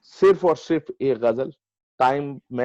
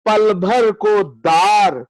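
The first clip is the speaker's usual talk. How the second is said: measured, higher mushaira declamation with lengthened vowels; ghazal recitation; 5 semitones higher, 6 dB louder, much slower than usual. Acted. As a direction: recite the verse grandly, drawing out each phrase.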